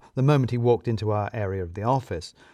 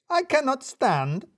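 much higher, slow, indignant; angry Radu; 13 semitones higher, slower than usual. A narrator's reading. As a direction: refuse to sit, furious. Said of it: "said Radu".

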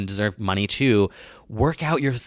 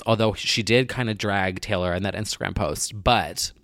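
usual speaking voice

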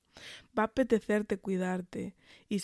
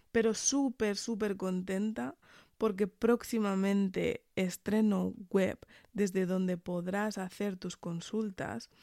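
ordinary delivery

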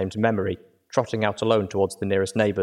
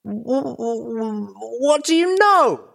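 stuttering outburst; 5 dB louder, slower than usual. Acted. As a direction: stammer then a much higher, louder shriek. Filly stammering into outburst.

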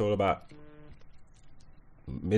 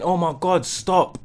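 alarmed cry; 11 semitones higher, 5 dB louder, much slower than usual. Retold or imitated. Nurse sees blood spurting.

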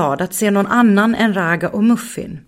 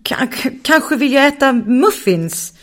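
halting stammer, a bit higher; 7 semitones higher than usual. Acted. Bonki svarar osäkert och stammande. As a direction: stumble unsure over the first word. Bonki hesitates, searching her thoughts.

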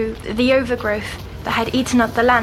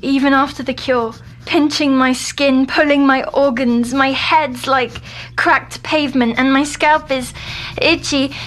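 raised, louder voice building to a groan; frantic with itching, getting more worked up; agitated distress; 3 semitones higher, 4 dB louder than usual. Acted. Tormented by itching, in growing distress.

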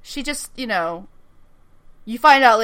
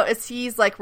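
usual speaking voice

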